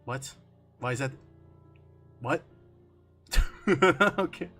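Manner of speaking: ordinary delivery, nothing marked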